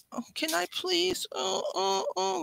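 put-on high whining plea of a sick child; whiny sick kid. Mother imitating her sick son, high whining voice. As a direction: whine it like a little boy begging. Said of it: "like whining".

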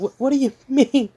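high, fast, bewildered questions; confused Ruby. Retold or imitated.

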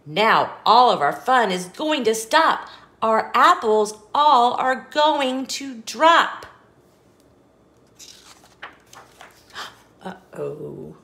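ordinary delivery, nothing marked

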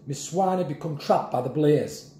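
usual speaking voice

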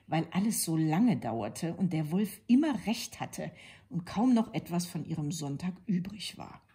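usual speaking voice

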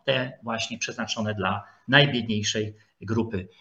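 ordinary delivery

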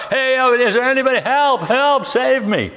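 high-pitched mock cry for help; mock scream. Climber hanging from a cliff, half-screamed plea for rescue. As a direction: call up for rescue, hammed-up but not truly screamed. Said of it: "he's screaming up top".

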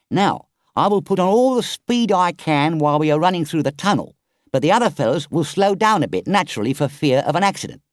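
brief him fast and firmly; urgent engine driver. Acted. Engine driver telling Toad how to escape, urgent.

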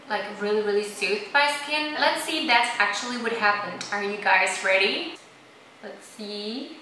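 speaking in sheet mask; wearing a face mask. Real, not acted. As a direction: lips through mask opening. Seen 3: Gold gel mask covers whole face all through; peeled off after 20s.

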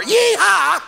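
very high loud whoop; drunk cowboy whoop. Retold or imitated. Drunk man bursting through the front door, loud high whoop. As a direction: let out a wild yeehaw.

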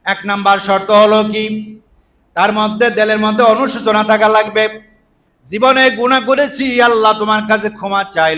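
high, loud sermon delivery; forceful preaching. Real, not acted. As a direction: call out each point to the congregation.

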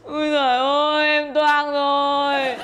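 slow, stretched-out comic wail; crying. Acted. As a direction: wail it out in hammed-up despair. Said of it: "đừng khóc".

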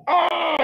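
high mock cry of labour pain; short high pained cry, imitated. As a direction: yelp like a woman in labour.

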